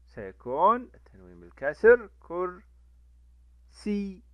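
كرسي stretched slowly with "-in"; slow example read. Shown as a demonstration. Teacher slowly modelling kasra tanween on a word. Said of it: "مثال".

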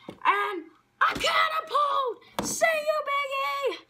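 high, shouted play-by-play call; excited shout. Acted. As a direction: yell it as the big move lands. Calling a wrestling catapult move.

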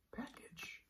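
Package whispered softly. Shown as a demonstration. Teacher whispering Package to the class, softly. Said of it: "let's go through our words".

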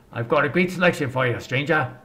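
ordinary talk, nothing marked

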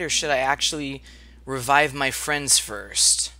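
ordinary talk, nothing marked

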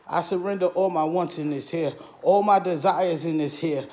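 usual speaking voice

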